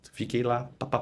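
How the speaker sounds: ordinary talk, nothing marked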